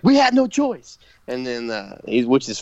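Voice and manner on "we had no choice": mock Nunzio, high pitched. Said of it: "And Nunzio's like".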